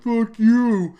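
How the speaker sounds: high, loud mock rant from an annoyed gamer; imitating a furious pubbie; angry player. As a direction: bark it like a fed-up teammate. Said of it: "I'm sure I'm pissing people off. Like".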